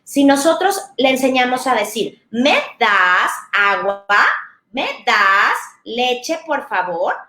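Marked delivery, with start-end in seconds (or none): 2.3-7.1 slow, sing-song "¿Me das agua? ¿Me das leche, por favor?" with rising tune; teacher modelling a polite request, slow and melodic. Shown as a demonstration. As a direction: stretch the melody so a child hears it. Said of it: "la entonación es súper importante".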